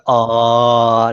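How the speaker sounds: loud sustained ah; vocal pitch warm-up. Shown as a demonstration. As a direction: slide the pitch from low. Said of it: "they start with a low and they go".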